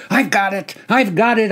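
high, loud hoarse shouting. Acted. Soldier at the head of the line, shouting. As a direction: bark it out manic. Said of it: "I shouted".

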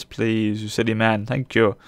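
ordinary talk, nothing marked

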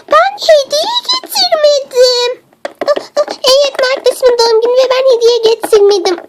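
high, gasping panic; gasp, then wail it high in dismay; panicked realisation. Acted. Realises they brought no gift.

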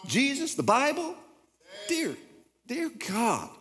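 very high, straining, impassioned pulpit cry; revved-up preaching. Real, not acted. Pastor scolding believers, preaching at full force. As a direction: preach it hard, voice soaring. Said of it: "I'm getting revved up here".

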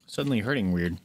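ordinary talk, nothing marked

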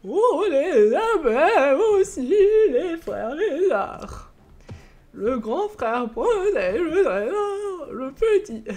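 put-on slow, high, sing-song riddling old man; Père Fouras voice. Shown as a demonstration. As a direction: chant it like an old riddle master. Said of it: "le vieux dans Fort Boyard".

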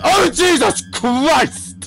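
high, drawn-out yelp of shock; real jump-scare fright; startled cry. Real, not acted. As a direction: yelp it, stretching the words out. Jump scare cuts the sentence short.

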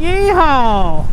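loud, high yeehaw whoop; cowboy whoop. Real, not acted. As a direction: whoop it out like a rodeo rider. Cheering the speeding car.

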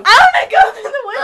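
high, very loud, fast; laughing shriek. Real, not acted.

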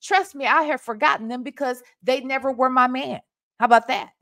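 ordinary delivery

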